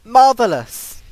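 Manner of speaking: sarcastic exclaim. Real, not acted. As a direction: spit it out sarcastically. Sarcasm after dying.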